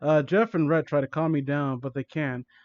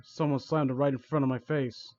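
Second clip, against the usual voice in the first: plain reading voice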